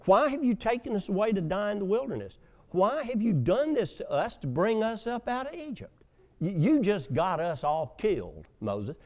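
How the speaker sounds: high, panicky accusing of Moses; fearful complaint. Acted. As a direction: blurt it out fast in fear. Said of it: "This is fear speaking".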